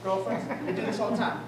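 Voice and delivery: normal talking voice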